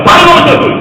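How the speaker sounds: high, loud demand to speak; commanding shout. Real, not acted. Pastor ordering the demon to talk. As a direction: order the spirit to answer.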